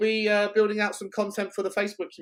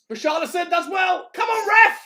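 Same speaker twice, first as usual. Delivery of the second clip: yelling from the sidelines; 13 semitones higher, 5 dB louder than usual. Real, not acted. Shouting at the referee.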